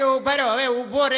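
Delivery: high, loud calls to stop; shouting stop. Retold or imitated. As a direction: yell after someone running off. Calling runaway to stop.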